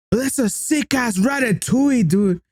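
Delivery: high, slow drunk-guy imitation; mock drunk. Drunk stranger admiring a Remy toy, lightly mocked. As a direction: drag it out high like a tipsy admirer. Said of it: "He sounds drunk as fuck".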